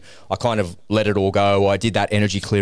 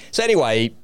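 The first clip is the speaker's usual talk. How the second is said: usual speaking voice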